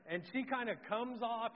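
usual speaking voice

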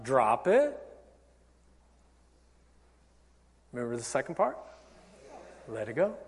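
low, soft, soothing reminder; calm letting-go. Shown as a demonstration. Calming answer to acted frustration.

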